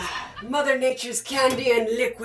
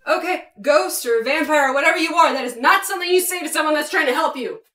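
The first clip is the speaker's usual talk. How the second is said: loud scolding; 4 semitones higher, 7 dB louder than usual. Acted. Scolding the ghost behind door.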